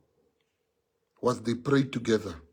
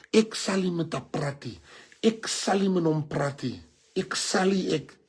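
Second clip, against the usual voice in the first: a higher, mock refusal; imitating a stubborn relative; 6 semitones higher, 3 dB louder than usual. Speaker mocks relative refusing to speak to family. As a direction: play the stubborn one who won't talk.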